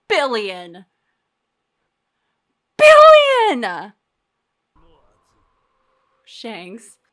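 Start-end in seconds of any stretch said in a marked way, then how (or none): 2.8-3.9 shocked shout. Real, not acted. Repeats bounty in shock.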